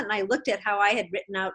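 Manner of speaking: ordinary talk, nothing marked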